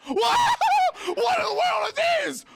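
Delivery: high, loud startled shriek with a laugh; shocked high yelp. Real, not acted. Sudden scare in game.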